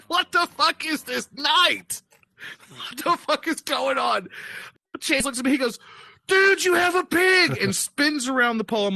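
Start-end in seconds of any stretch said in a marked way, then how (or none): 0.0-4.3 exasperated disbelief. Retold or imitated. Storyteller seeing friend on the stripper pole, mock exasperation. Baffled by the chaotic night. 6.3-7.6 high, loud gleeful exclamation; excited amazement. Retold or imitated. Young man on the pole spotting the pig, thrilled.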